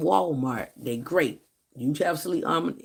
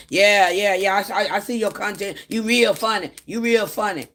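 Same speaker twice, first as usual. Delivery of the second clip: louder, higher mock brush-off; imitated dismissive host; 4 semitones higher, 7 dB louder than usual. Host brushing off the speaker, mock dismissive. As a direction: toss off the compliment back half-heartedly. Host's dismissive reply voiced.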